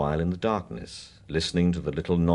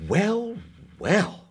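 bright, eager and higher; enthusiastic. Acted. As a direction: relish the memory. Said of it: "said Mister Heine enthusiastically".

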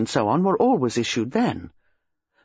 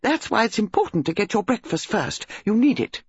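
high, gushing country lady; excited hostess; 7 semitones higher than usual. A narrator's reading. Mrs Bantry telling Jane about the body, high gushing. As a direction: rattle on, thrilled and scandalised.

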